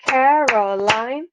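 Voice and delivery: Caroline slowly split, Ca-ro-line; syllable by syllable. Shown as a demonstration. Teacher counting beats in a name, Caroline syllable by syllable. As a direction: clap out Caroline in three long beats. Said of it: "three beats in her name".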